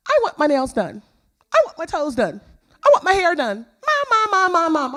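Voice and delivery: high, loud child imitation; demanding little girl. Little girl wants her hair and nails done, imitated loud pestering. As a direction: pester mom in a high, insistent voice. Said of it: "But I get this little girl".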